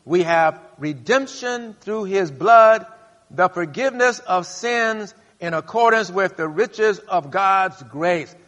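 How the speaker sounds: slow, loud scripture proclamation; booming pulpit reading; preaching. Acted. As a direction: proclaim each word slowly.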